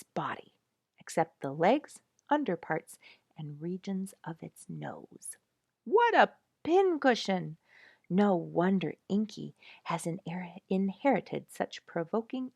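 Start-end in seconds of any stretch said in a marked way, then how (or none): 5.8-7.6 higher and louder, marvelling; amused exclamation. Acted. Narrator marvels at the porcupine to listeners, delighted exclamation.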